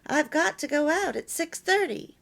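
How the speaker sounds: sharp, demanding wife's command; demanding nag. Shown as a demonstration. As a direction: snap the order at him like a nagging wife. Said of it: "You can see the difference".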